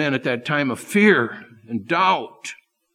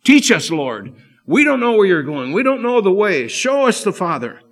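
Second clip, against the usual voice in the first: higher and louder, a desperate plea for help; crying out; 5 semitones higher, 5 dB louder than usual. Acted. Frightened disciples crying out to Jesus, pleading and loud. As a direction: call out to the Lord for help. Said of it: "they cried out to Jesus".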